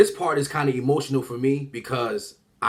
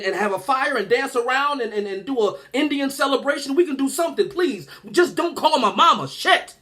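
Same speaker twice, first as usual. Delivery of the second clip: high, rambling plea to avoid trouble; pleading and scrambling for a way out; frantic negotiating; 6 semitones higher than usual. Retold or imitated. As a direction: bargain desperately, tripping over words in a raised pitch. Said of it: "I'm negotiating like a motherfucker".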